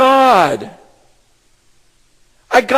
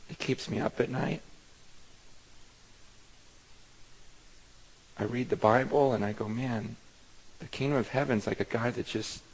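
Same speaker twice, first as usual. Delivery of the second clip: hushed confession; 13 semitones lower, 19 dB quieter than usual. Real, not acted. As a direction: drop almost to a hush, as if sharing a secret fear. Pastor confesses fear of hell.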